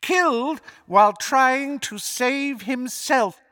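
high villain voice, savouring it; relishing the lie. A narrator's reading. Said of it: "relish in his voice for the lie".

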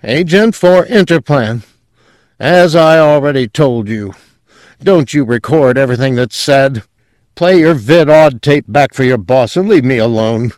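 loud, high, dripping with hostility; hostile drawl. A narrator's reading. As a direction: draw the words out with open hostility. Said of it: "drawling the words out so that they dripped with hostility".

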